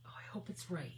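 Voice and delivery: anxious whisper; tense whisper as the stamp goes down. Real, not acted. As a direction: hold your breath and whisper it.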